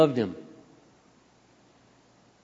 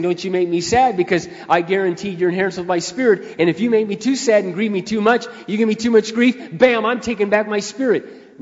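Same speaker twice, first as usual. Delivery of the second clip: mock threatening God; 6 semitones higher, 5 dB louder than usual. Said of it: "He's not saying".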